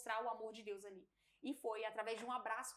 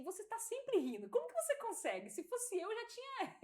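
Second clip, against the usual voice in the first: amazed colleague; 12 semitones higher, 6 dB louder than usual. Retold or imitated. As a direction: ask it as if you can't believe it. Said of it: "ela virou pra mim e falou assim". (colega espantada, voz mais aguda)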